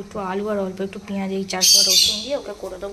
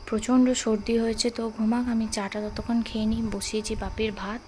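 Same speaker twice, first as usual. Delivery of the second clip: ordinary delivery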